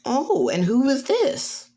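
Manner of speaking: high, drawn-out curious question; high-pitched, slow parental prying; nosy parents. Acted. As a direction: stretch it out with teasing interest. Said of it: "Inko and Asashi go".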